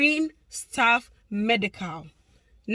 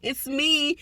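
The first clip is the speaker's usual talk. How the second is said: much higher, slow, sing-song greeting; imitating a fan; 14 semitones higher, much slower than usual. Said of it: "please don't hesitate to say".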